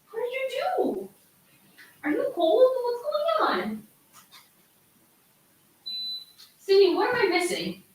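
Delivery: high, soft coaxing voice to a frightened dog; worried pet talk. Real, not acted. Owner asks her shaking dog what's wrong, high anxious coo.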